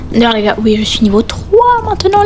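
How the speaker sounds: ordinary talk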